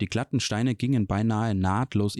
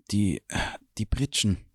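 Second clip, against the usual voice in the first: stammering. A narrator's reading. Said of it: "stammelte er".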